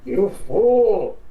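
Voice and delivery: a touch of a loud villain sneer; lightly voiced gloating villain; mocking villain. Ganon gloating over Link's death, loud mocking villain.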